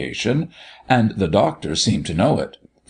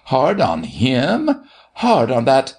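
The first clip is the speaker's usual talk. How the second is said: higher, rising indignant repeat; indignant interruption; 5 semitones higher than usual. A narrator's reading. Doctor interrupting Martha's plea, indignant repeat. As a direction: stretch the echoed question upward in protest. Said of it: "the doctor interrupted".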